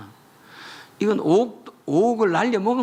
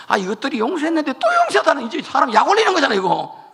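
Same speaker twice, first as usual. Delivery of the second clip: exasperated mock grumble; 8 semitones higher, 6 dB louder, faster than usual. Preacher voices God's exasperation.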